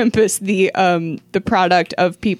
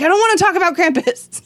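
higher and fast, lightly voiced old lady; imitating grandmother; 9 semitones higher, faster than usual. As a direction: toss it off like a fed-up granny. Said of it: "She's like".

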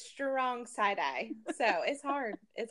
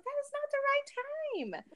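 남편을 흉내 내듯 훨씬 높은 목소리로 "That is not the right time."을 말함; 어이없다는 듯 확 높아진 톤으로 타이르기; mock scolding; 4 dB quieter than usual. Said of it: "I was like".

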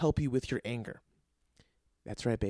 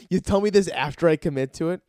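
loud, higher-pitched protest; mock indignant; 5 semitones higher, 9 dB louder than usual. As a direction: protest in hammed-up outrage at being told too late. Retold dismay at manager's late warning.

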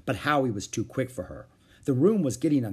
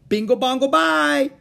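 very high, loud chant; shouted; 9 dB louder than usual. A narrator's reading. Howie shouting nonsense to make noise. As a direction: yell nonsense just for noise. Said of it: "shouted Howie".

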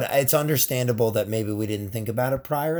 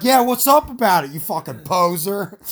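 much higher and louder taunt; skit colonist taunt; 7 semitones higher, 5 dB louder than usual. Pretend. Colonist taunts the redcoats in the skit, high loud challenge. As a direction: square up to the redcoats. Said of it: "Let's do a skit".

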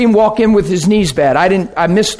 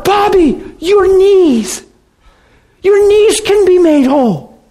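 high-pitched imitation of eager believers; acted breakthrough excitement, pitched way up; excited urging; 9 semitones higher, slower than usual. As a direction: call to him with eager, rising faith. Men rush over to pray excitedly.

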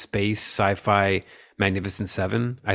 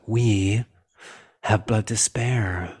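sultry villain voice; much slower than usual. Retold or imitated. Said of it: "No, she's like".

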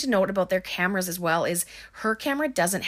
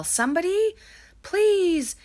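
imitating a desperate yell, much higher and louder; 13 semitones higher, 4 dB louder, slower than usual. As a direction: cry out for help from the dark. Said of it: "we hear Josh yell".

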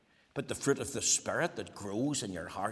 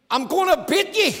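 shouted threat, much higher and louder; furious threat; 14 dB louder than usual. Retold or imitated. Preacher acting out a husband threatening his wife on the phone. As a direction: bellow the threat at her.

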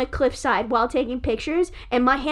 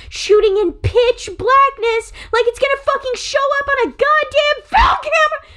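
rising angry shout; yelling in real photographer outrage; furious rant; 12 semitones higher, 6 dB louder than usual. Real, not acted. Ranting at her cohost about bad film photography, shouting. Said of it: "I gotta calm down".

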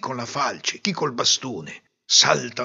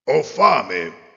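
wolf voice; 5 dB louder than usual. Acted. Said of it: "il lupo disse".

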